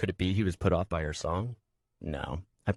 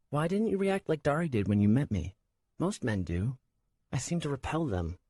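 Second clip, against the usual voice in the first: put-on high, hurt young woman; girl voice; 7 semitones higher than usual. A narrator's reading. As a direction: ask it with wounded shyness. Shara's voice.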